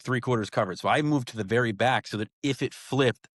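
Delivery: usual speaking voice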